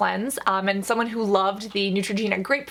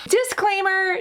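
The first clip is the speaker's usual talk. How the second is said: emphatic announcement; 10 semitones higher, slower than usual. Real, not acted. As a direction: sing out the warning.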